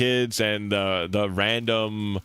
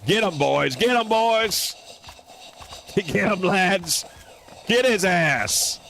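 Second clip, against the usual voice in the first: higher, punchy urging with laughter; excited cheering; 10 semitones higher than usual. Real, not acted. Player urging his little buddies to attack, excited cheering. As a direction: egg the troops on gleefully.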